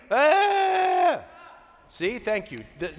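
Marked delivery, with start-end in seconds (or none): 0.1-1.2 a sudden loud high wail; demo wail. Shown as a demonstration. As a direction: let out one short mock wail for the crowd. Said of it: "wailing, we could all do it right now. Ready?".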